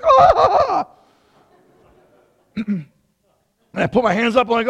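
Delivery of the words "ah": loud, high startled "ah"; mock scream of alarm, pitched way up; panicked scream. Trainee screamed at mid-drill, startled cry.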